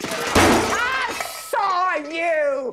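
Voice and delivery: wife screaming at her cheating husband, crying. Acted. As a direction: scream it at him through tears.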